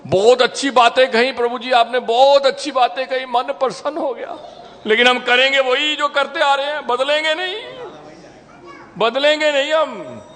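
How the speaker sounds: high sing-song mock praise, then stubborn refusal; mock listener. The teacher mocking his audience's fake agreement, high and sing-song. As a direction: parody a listener who nods but won't change.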